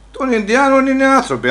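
high, insistent retort; worked-up objection; indignant protest. Acted. Loud protest after being hushed.

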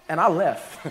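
says it through a laugh; chuckling at his own walkout. Real, not acted. Pastor laughing at his own story to the congregation, chuckling.

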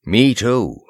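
usual speaking voice

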